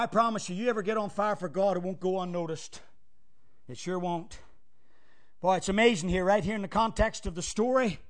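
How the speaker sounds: normal talking voice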